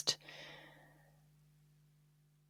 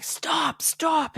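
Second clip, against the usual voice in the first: mom saying stop; 9 semitones higher than usual. Retold or imitated. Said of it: "the mom was like".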